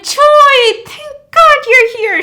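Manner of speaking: very high, very loud squeal; over-the-top girlfriend. Retold or imitated. Choi's girlfriend greets him, high loud relieved squeal. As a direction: squeal it in huge exaggerated relief. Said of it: "Choi's girlfriend is like".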